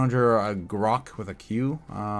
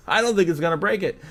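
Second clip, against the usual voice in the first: laughing, fast, a little higher and louder; laughing at himself; 6 semitones higher, 3 dB louder, faster than usual. Real, not acted. Repeats his own wrong call.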